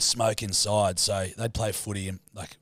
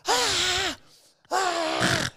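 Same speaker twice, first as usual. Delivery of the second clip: much higher cries of pain; a boy's hammed-up screaming; mock childish scream. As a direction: wail like a kid with ash in his eye. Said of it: "I just start screaming".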